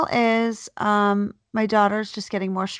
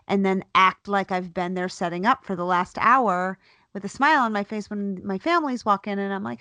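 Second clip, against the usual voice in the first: normal talking voice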